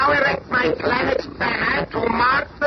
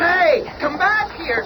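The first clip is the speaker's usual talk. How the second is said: high, slowed call after a leaving customer; calling after someone; 7 semitones higher than usual. Acted. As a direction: shout after him to come back. Clerk calls after departing customer.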